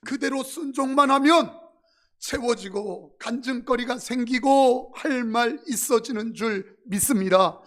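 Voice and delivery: full-force preaching. Acted. Pastor exhorts the congregation at full volume.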